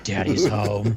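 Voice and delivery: a hint of a creepy villain; creepy promo line. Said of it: "He's like".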